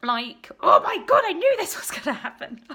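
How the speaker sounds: breaking into tears through a laugh; real crying. Real, not acted. Vlogger recalling a homeless mother, breaking into real tears. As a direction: voice cracking high as tears come. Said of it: "Oh my God, I'm crying again".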